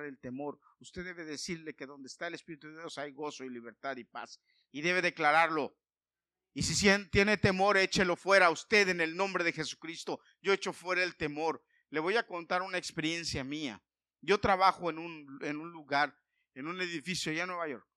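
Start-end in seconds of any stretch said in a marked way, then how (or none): 4.7-14.4 full-force preaching. Acted. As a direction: proclaim it to the congregation.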